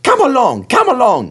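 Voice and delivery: high, loud, impatient calling; bossy engine hurrying the coaches; rudely shouted orders. A narrator's reading. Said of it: "he called rudely".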